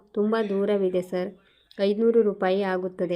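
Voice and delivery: plain reading voice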